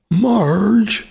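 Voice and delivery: high strained cartoon dad call; Homer Simpson. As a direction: yell for Marge like a cartoon dad. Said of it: "did I just sound like fucking Homer Simpson".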